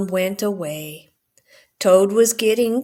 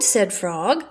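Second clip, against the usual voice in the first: ordinary delivery, nothing marked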